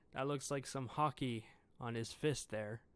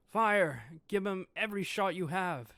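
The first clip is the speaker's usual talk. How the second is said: a loud, higher battle command; shouted order; 4 semitones higher, 6 dB louder than usual. Acted. The commander orders every gun fired at Kuma, loud shout. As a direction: yell it across the battlefield.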